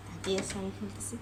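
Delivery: normal talking voice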